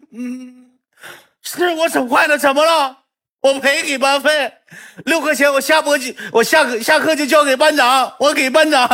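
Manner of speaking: put-on boy admits breaking the dustpan, high and defiant; defiant kid. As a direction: toss it off like a brat daring you.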